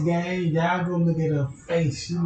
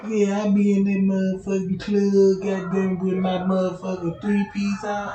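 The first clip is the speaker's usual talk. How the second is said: ordinary talk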